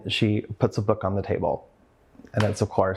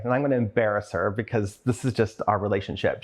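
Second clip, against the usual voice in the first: ordinary delivery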